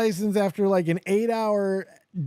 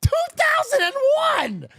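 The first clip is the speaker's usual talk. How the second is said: shouted much higher and louder, thrilled; excited shout; 4 dB louder, faster than usual. Retold or imitated. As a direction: yell the year in joyful disbelief. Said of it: "I go".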